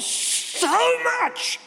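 grandson's high gleeful answer; toddler's "so much". Retold or imitated. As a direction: sing it out like a three-year-old. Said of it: "How much do you love Pop?".

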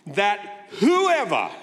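slow, deliberate scripture reading; measured solemn verse recital; slow recited verse. Shown as a demonstration. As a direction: read it out slowly like scripture. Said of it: "So here it comes".

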